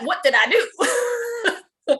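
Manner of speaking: high, slow, drawn-out laughing "what did I do"; laughing disbelief. Retold or imitated. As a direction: stretch it out through a laugh. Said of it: "But I'm like".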